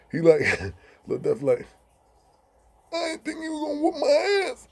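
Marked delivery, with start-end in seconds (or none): 2.9-4.7 high laughing-crying deaf voice; deaf friend voice. Retold or imitated. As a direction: wail it through a laugh.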